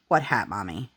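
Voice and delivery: put-on low, soft, deadpan child's question; dead serious. Toddler looking at mom, forgetting the hat, dead serious. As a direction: imitate a kid's innocent deadpan, dropped low and quiet. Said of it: "like, dead serious".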